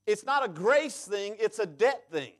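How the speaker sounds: ordinary talk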